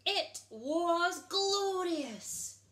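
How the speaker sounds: drawn-out delight; savoured. A narrator's reading. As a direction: stretch it out in awe. Narrator savours the jelly.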